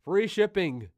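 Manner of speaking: sudden loud blurt; loud outburst. Real, not acted. Blurted slip of the tongue.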